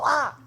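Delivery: sudden high yelp, like a rat just popped up; startled yelp. Retold or imitated. Inmate retelling a rat leaping out of the toilet, high yelp. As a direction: jump back with a sharp "Ah!". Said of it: "and you go".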